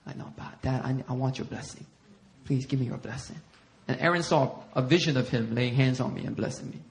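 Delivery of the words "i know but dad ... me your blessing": low, hushed entreaty; gentle pleading. Retold or imitated. Son asking dad for a blessing, soft plea. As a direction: plead softly and earnestly.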